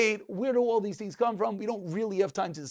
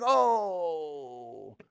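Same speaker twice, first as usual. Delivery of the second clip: long drawn-out "oh", sliding down; relieved "oh"; 2 semitones lower than usual. Acted. Teacher acting out burnt finger in the mouth, drawn-out relief. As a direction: let out one long soothing "oh".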